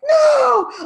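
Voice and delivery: sharp high shouted no, half crying; a loud shouted refusal to her brother; emphatic no. Retold or imitated. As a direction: cut him off with a loud, high no. Retelling her reply to scared brother.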